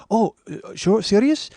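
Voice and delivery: imitated Japanese businessman, pleasantly taken aback; soft Japanese accent. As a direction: ask in disbelief with a halting Japanese accent. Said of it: "gonna do that Japanese voice".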